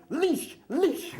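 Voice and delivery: high-pitched imitation of her calling out; retold yell. Woman turns round at dog owner, high mock yell. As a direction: mimic her yell, one word at a time. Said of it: "and she yells".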